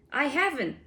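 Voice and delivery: high, loud protest; giant shouting. A narrator's reading. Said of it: "the giant shouted".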